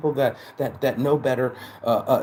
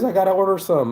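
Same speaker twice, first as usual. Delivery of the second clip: high, loud imitation of a keen buddy; imitated eagerness; 8 semitones higher, 6 dB louder than usual. Buddy wanting to order a hoodie, imitated eagerly. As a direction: mimic an eager customer, pitched up.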